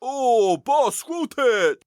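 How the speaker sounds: high, slow, whiny henchman; whimpering henchman. Pretend. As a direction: cower before the boss. Henchman addressing villain.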